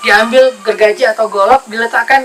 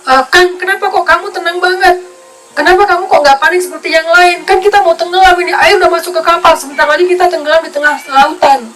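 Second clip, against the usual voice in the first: anxious wife; 7 semitones higher than usual. Acted. Istri bingung bertanya pada suami.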